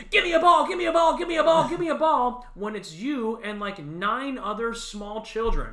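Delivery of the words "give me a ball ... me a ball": high, loud whiny begging, over and over; mock grown man begging. Mocking a grown fan begging the bat boy, high and loud. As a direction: ham up a grown man pleading for a foul ball. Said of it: "run down and go".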